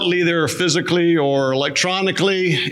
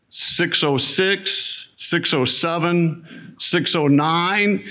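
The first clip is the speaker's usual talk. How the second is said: each time said slowly and deliberately; slow, drawn-out listing; much slower than usual. Shown as a demonstration. Pastor listing his alarm times, slow and deliberate. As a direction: spell out the odd times slowly.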